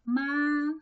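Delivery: MA high, loud and level; first tone. Shown as a demonstration. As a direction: hold MA steady on a high pitch. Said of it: "Let's practice".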